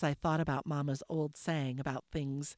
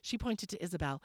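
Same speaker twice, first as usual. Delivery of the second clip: plain reading voice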